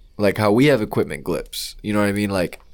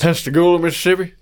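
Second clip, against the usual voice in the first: put-on Southern accent; 8 semitones higher, 4 dB louder than usual. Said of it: "enjoy trying to do a Southern accent".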